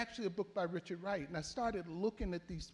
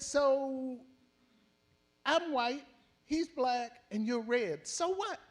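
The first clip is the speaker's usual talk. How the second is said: retold retort; 7 semitones higher, 5 dB louder, slower than usual. Tex's quoted retort in fight.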